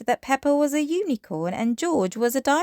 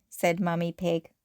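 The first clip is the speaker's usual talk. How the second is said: ordinary delivery, nothing marked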